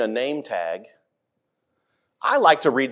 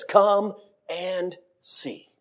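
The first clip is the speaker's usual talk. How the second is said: gentle invitation; 3 semitones higher, 5 dB quieter, much slower than usual. Acted. Philip's gentle invitation retold.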